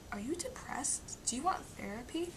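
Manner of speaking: mock the parents' careful worry; whispering like a concerned parent; whispered concern. Parents' worried question, quoted.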